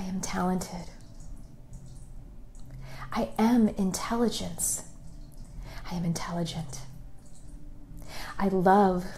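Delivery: soft, hushed affirmations, drifting into whispers; guided meditation. Acted. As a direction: lead them gently into self-love, half whispering.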